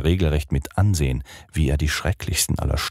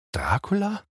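erschrocken; 4 dB quieter than usual. Acted. Mina fragt erschrocken nach Dracula.

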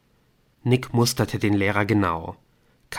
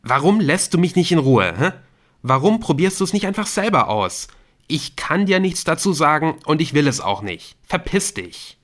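higher, louder angry yelling; boy snapping at a pestering classmate, furious; sudden furious outburst; 7 semitones higher, 4 dB louder than usual. Acted. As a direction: lash out at him, fed up. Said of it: "war mit einem Mal unsagbar wütend".

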